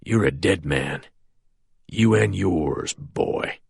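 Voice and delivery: low threat. A narrator's reading. Prisoner threatening the sheriff.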